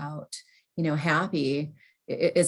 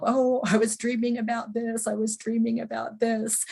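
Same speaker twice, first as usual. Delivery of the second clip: higher, evenly pitched self-reminder; higher-pitched note to self; repeating to oneself; 7 semitones higher than usual. Retold or imitated. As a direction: repeat it like a memory aid, pitched up. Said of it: "kind of repeat to myself".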